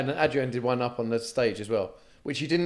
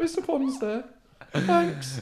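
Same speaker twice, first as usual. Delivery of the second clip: high timid voice; 12 semitones higher, much slower than usual. Retold or imitated.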